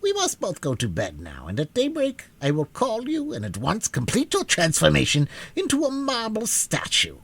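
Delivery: put-on a stern old witch, pleased with her plan; lightly voiced old witch, gloating; old witch Mombi. A narrator's reading. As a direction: voice the witch cold and self-satisfied.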